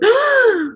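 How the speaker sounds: sharp gasp for air, high and loud. Retold or imitated. Young man surfacing from water, loud high gasp. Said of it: "gasps for air and goes".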